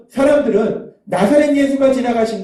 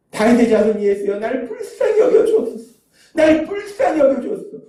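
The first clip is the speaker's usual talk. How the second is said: acted imploring wail, leaping high without extra volume; wailing cry for mercy; 4 semitones higher than usual. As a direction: let the plea soar like a desperate beggar. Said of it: "맹인이 그렇게 부르짖는".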